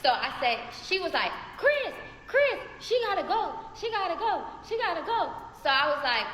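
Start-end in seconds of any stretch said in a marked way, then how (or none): 1.6-5.3 high, urgent pleading to Chris; hammed-up frantic lady; mock frantic calling. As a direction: imitate her panicking, voice pitched way up. Lady panicking, calling baby daddy.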